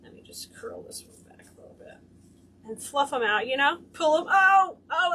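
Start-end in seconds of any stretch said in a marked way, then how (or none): 0.0-2.0 low whispered aside to herself. Real, not acted. Muttering while curling ribbon. 4.3-5.1 high, loud delighted oh oh; excited cry. Real, not acted. Excited about the fluffed tails.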